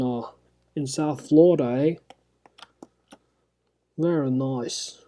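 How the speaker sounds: a cheeky fake Aussie, half-joking; Australian accent. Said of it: "I started speaking with an, an Australian accent".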